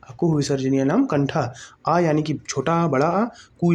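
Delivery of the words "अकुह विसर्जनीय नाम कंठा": fast run-through of अकुह विसर्जनीय नाम कंठा; brisk recital, no pauses; quick recap. Shown as a demonstration. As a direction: rattle off the rule at speed. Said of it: "क्या आप भी लिखेंगे मेरे साथ".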